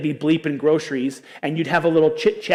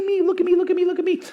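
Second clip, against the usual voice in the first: much higher, whiny begging pup; a pet pleading to be noticed; needy dog voice; faster than usual. Retold or imitated. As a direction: beg for attention like the family dog. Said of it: "Our dog does this".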